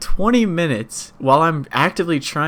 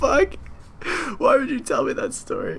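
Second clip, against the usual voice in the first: mock shock; 11 semitones higher than usual. Retold shocked reaction.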